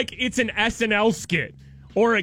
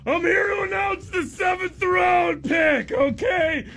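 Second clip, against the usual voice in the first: sneering wrestling villain; 4 semitones higher, slower than usual. Retold or imitated. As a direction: heckle the crowd like a heel. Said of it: "they just parade him out there".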